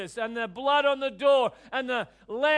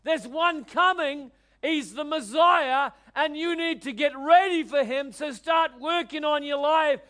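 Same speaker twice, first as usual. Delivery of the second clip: fiery prophet preaching; 4 semitones higher, 3 dB louder than usual. Retold or imitated. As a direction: preach it like John the Baptist crying out. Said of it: "he was saying to them".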